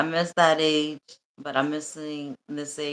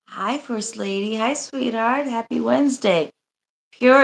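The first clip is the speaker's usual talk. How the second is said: excited greeting; 6 semitones higher, 6 dB louder than usual. Real, not acted. Greeting chat members on stream.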